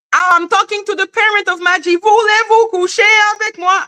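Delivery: high mocking imitation; imitating eighty-two. Eighty-two addressing the parents, high mocking impression. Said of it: "listening to eighty-two say".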